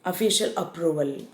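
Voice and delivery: ordinary talk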